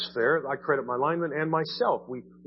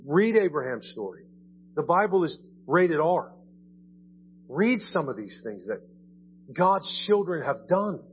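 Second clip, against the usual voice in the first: normal talking voice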